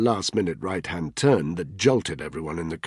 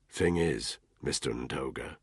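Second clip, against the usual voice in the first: low and cold; 6 semitones lower, 7 dB quieter than usual. A narrator's reading. Danny confronting Ntoga coldly.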